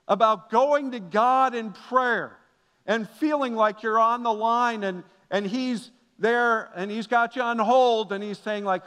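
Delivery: ordinary delivery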